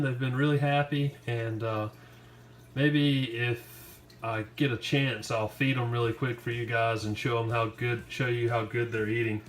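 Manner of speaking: ordinary talk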